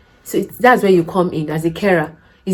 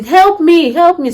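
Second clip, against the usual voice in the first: mock shout for help; 9 semitones higher, slower than usual. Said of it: "they will start shouting".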